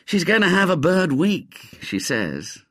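lightly voiced gloomy schoolboy, pitched high; mild gloom, high pitch. A narrator's reading. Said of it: "said Ginger gloomily".

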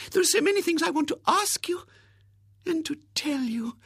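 high, breathless, whispered gush; eager whisper. Acted. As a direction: whisper it like a giddy secret. Miss Rubina confides eagerly.

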